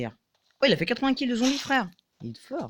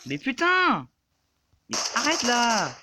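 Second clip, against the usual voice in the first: sharp annoyed shout, higher and louder; exasperated yell; 6 semitones higher, 4 dB louder, slower than usual. Real, not acted. Gamer yelling at the enemy attacking him, annoyed shout. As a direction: snap at the attacker.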